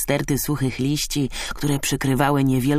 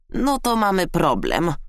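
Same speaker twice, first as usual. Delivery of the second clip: higher, sighed verdict falling away; sighing hare; 6 semitones higher than usual. A narrator's reading. Hare facing a shared problem, mild sigh. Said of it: "westchnął zając".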